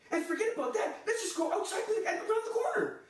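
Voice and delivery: high-pitched, overwrought rant; worked up. Acted. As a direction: voice climbing as the outrage builds. Worked-up rant, near to tears.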